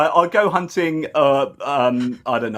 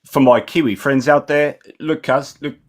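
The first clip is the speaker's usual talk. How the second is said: put-on matey Kiwi accent; New Zealand accent. Guest thanking his Kiwi friends for support, New Zealand accent. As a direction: lay on your best New Zealand accent. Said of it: "putting on my best New Zealand accent".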